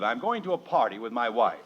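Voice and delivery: ordinary talk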